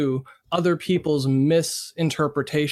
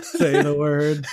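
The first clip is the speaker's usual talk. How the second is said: teasing; slower than usual. Retold or imitated. Echoing the teasing demand.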